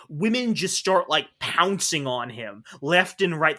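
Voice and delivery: normal talking voice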